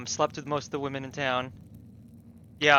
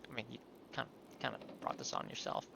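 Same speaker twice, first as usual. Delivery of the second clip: whispered aside; 4 semitones lower, 16 dB quieter than usual. Real, not acted. Muttered aside about the character.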